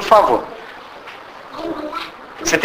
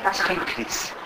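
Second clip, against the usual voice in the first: queixa baixinha; 14 dB quieter than usual. Retold or imitated. Imitando alguém em crise.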